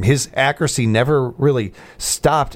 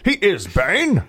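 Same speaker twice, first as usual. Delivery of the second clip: high put-on villain voice with a laugh; mock the villain's voice, amused; Bane; 7 semitones higher, slower than usual. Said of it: "He does the voice of Bane".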